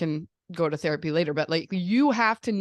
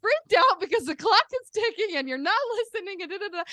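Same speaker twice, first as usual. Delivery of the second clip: high, loud, frazzled mom protest; mock yell; 11 semitones higher, 4 dB louder than usual. Mom shouting back at her kid, high and loud. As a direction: defend yourself in a high flustered yell. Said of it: "And I'm like".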